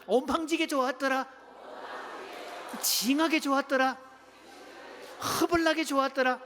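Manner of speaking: playful Jeolla dialect exclamation; hearty Jeolla-style cry of delight. Shown as a demonstration. Pastor giving the church the Jeolla version, lively Jeolla dialect. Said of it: "전라도 버전 들어갑니다".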